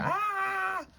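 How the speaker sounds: long, very high "Ah!" scream; play-acted high-pitched scare scream; scary scream. A narrator's reading. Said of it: "I screamed".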